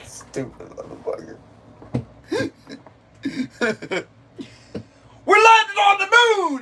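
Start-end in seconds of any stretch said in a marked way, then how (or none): 5.3-6.6 high, whooping comic yell; imitated excited shout. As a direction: shout it like astonishing news, way up high. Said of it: "How he said that?".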